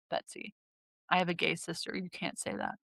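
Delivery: quiet deadpan scolding, imitated; straight-faced. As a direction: deliver the prank with a straight face. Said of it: "I pulled the best straight face".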